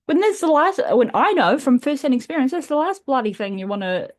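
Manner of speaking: high and loud, worked-up outburst; exasperated rant. Real, not acted. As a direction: blurt it out, exasperated. Ranting about unwanted meals.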